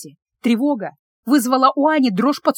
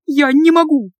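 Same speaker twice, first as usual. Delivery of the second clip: raised, loud tearful plea; convulsive sob; 4 semitones higher, 5 dB louder than usual. A narrator's reading. Anya answering the phone in tears, sobbing. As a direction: cry it out higher and louder, on the verge of sobbing. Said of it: "судорожно всхлипнула".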